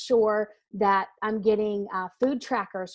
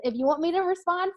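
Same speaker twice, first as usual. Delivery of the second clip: higher, firm mock order; stern demand; 8 semitones higher than usual. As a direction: bark the order back at her, half-joking. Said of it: "I'm like".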